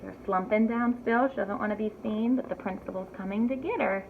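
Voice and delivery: usual speaking voice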